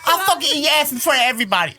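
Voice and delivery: fast laughing Tyson quote; imitating Tyson, laughing. Podcast host quoting Tyson's clip to friends, fast and laughing. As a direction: rattle off Tyson's threat through laughter. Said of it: "Can you imagine?".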